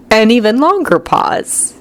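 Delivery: usual speaking voice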